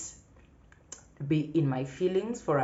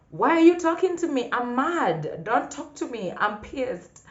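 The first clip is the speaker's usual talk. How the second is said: much higher and louder mock indignation; mock mad; 10 semitones higher, 7 dB louder than usual. Daughter snubbing mom after a scolding, mock mad. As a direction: play the sulking daughter who is mad. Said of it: "And I'm wondering".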